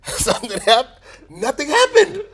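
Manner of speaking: high, loud and playful; excited. Real, not acted. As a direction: squeal it out jokingly. Joking about side effects.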